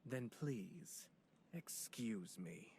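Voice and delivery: slow, breathy parting whisper; a soft whispered excuse me. Acted. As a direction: breathe it out as you slip away. Slipping away politely after refusal.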